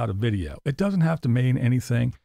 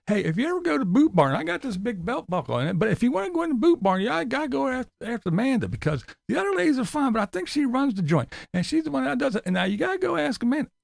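high, fast mocking impression; mock the Boot Barn pitch, pitched up; mocking Tim; 9 semitones higher, faster than usual. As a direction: ham up the repetitive vlogger. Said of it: "And then back to".

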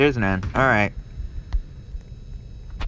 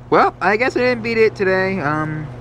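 ordinary delivery

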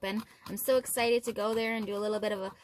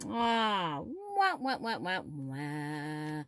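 sad trombone wah wah; 4 semitones lower, much slower than usual. Real, not acted. Losing trombone imitation.

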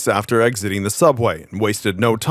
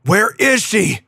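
high-pitched demanding cry; roar; 7 semitones higher, slower than usual. A narrator's reading. Otto chases the goblin into tunnels, roaring. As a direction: demand it in a rage, pitch raised. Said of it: "Octavius roared".

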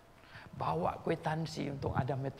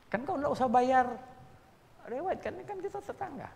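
eager persuasion; 11 semitones higher than usual. Retold or imitated. Persuading neighbour in story.

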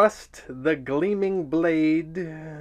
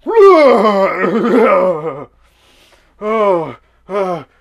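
dazed shout; 5 semitones higher, 12 dB louder, slower than usual. Pretend. He-Man dazed after teleport.

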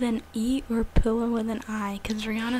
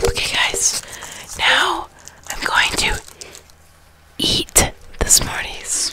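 whispering; 7 semitones higher, 5 dB louder, much slower than usual. Real, not acted. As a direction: whisper it close to the mic.

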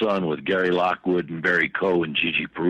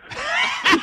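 high laugh. Real, not acted. Laughs at his own fake.

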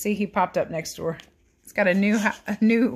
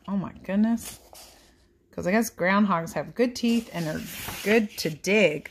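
usual speaking voice

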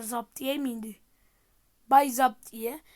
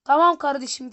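loud; 12 dB louder than usual. Real, not acted. Loud sarcastic response.

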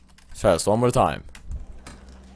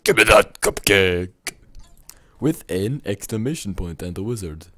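ordinary talk

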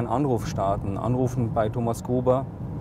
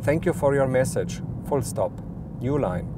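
dictating. Shown as a demonstration. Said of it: "you can freely dictate text".

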